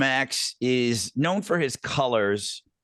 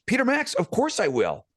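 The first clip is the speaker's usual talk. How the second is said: higher, eager German-free starstruck yes; excited fan reply, much higher; thrilled eager reply; 8 semitones higher than usual. Retold or imitated. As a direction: answer like a starstruck fan jumping at the chance. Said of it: "I'm like".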